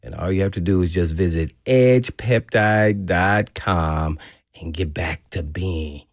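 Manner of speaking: smooth, steady sponsor read. Acted. Host reading a sexual health sponsor ad on air, smooth pitch. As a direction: sell it like a radio spot.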